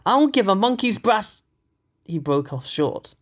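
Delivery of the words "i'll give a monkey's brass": angry outburst cut off. A narrator's reading. Foster's rage cut off.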